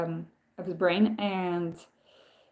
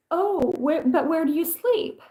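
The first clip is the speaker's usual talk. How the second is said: put-on puzzled child; 8 semitones higher than usual. Child guest puzzled about bed.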